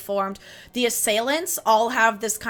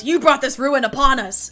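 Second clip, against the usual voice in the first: loud accusing shout; shouting villager; 4 semitones higher, 7 dB louder than usual. Retold or imitated. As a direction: yell it at the fallen family. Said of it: "they're shouting things like".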